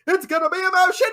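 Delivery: loud, high, rushed mock roar; hammed-up hard-man catchphrase; Vinny Jones. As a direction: bellow it like a British brawler. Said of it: "Vinny just goes".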